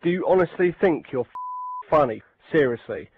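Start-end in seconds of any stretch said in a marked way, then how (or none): none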